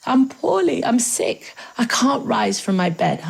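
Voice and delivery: high, feeble plea of feigned illness; weak sick voice. A narrator's reading. Anansi pleading sick to Tiger, in a weak, high voice. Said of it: "he says in a very weak voice".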